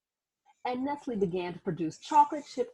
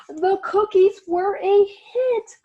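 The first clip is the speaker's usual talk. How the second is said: much louder and higher, slow; triumphant cheer; 11 semitones higher, 11 dB louder, slower than usual. Acted. Narrator celebrating the cookies' success, loud and high. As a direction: shout it out like a celebration.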